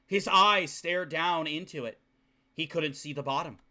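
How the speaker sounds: low, soft narration read from the novel; story narration. Acted. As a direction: read the bleak scene quietly and low.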